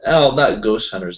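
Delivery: ordinary talk, nothing marked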